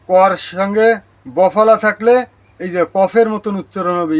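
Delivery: normal talking voice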